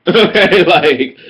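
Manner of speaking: loud burst of laughter; laughing. Real, not acted. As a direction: laugh hard then keep talking. Laughing at own comeback.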